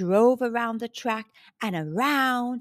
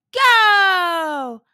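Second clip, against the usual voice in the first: long, high, loud start call; starting shout; 7 semitones higher, 9 dB louder than usual. A narrator's reading. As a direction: shout the racers off. Starter sends racers off.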